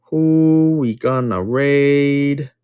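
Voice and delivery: "Who we gonna raid?" stretched very slow, sing-song; drawn-out question. Shown as a demonstration. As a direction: drag every word of "Who we gonna raid?" out slowly. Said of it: "repeated stretched out".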